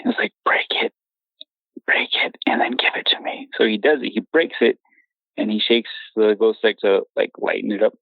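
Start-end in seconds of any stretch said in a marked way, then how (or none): 0.4-3.5 hushed, retold command; whispered instruction. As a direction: whisper short orders to a friend. Whispered instructions while hiding.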